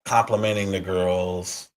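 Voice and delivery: normal talking voice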